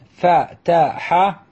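فتح يفتح يفعل slowly in separate beats; each syllable of يفتح and يفعل held apart. Shown as a demonstration. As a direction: sound out fa-ta-ha, yaf-ta-hu for فتح يفتح. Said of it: "مثل".

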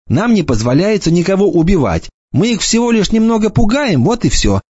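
light mocking boy's voice; lightly voiced cocky boy. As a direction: toss it off like a smug teenager. Enoch's voice.

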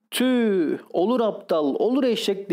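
high-pitched, dismayed self-scolding; exasperated self-reproach. A narrator's reading. Husband hitting his own head, exasperated self-reproach.

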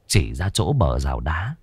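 ordinary delivery

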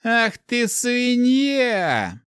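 high, very drawn-out furious insult; long, high cry of rage; enraged roar. A narrator's reading. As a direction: bellow the insult, stretching it out. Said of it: "прорычал Ярис".

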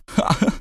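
a jittery, high forced "ха-ха"; nervous cackle. Acted. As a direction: laugh out nervously. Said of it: "нервно заржал он". (нервный смешок)

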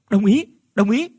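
loud, high shout of agreement; group cheer. Acted. Friends shouting agreement to Hải's plan, loud and high. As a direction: cheer it out together, hands in the air.

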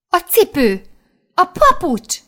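sudden high, loud cry; shouting. A narrator's reading. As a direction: blurt it out in alarm. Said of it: "Kiáltottam". (ijedt, hangos, magas kiáltás)

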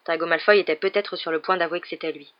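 ordinary delivery, nothing marked

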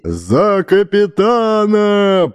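high slow thundering toast; bellowing over the wind. A narrator's reading. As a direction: boom it over the tables. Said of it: "прогремел он над столами".

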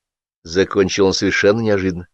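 ordinary delivery

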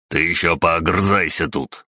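usual speaking voice

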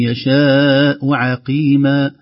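"عقيما" chanted very slowly, light ain. Shown as a demonstration. Teacher recites with a light ain. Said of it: "نرقق العين".

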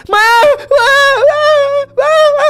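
high frantic calls of má; yelling for mom. Retold or imitated. Boy calling out for his mom from the road, high and frantic. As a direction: shout má from far down the road. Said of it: "tôi la làng vậy đó".

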